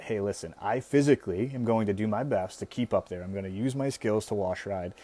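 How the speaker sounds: normal talking voice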